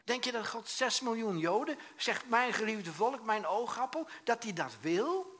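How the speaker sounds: loud, high, impassioned sermon; full-force preaching. Real, not acted. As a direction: hammer the rhetorical question home at high pitch. (luid en fel preken)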